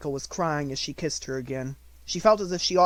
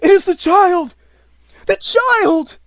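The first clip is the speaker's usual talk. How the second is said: much higher, much louder astonished gasp; gasping in shock; 16 dB louder, slower than usual. A narrator's reading. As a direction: gasp it out, overwhelmed. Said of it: "Then Valid gasped".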